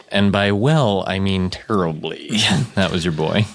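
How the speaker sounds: normal talking voice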